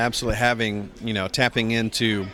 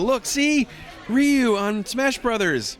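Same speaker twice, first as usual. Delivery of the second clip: higher, lightly voiced excited girlfriend; 12 semitones higher, slower than usual. Fiancee waking him on his birthday with Ryu news, higher excited voice. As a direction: imitate an eager girlfriend breaking news. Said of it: "She looks on her phone and says".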